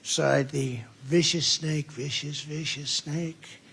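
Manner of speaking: slow, theatrical recital of a fable; dramatic poem reading. Acted. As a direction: read it like a stage poem with pauses. Reads The Snake poem aloud.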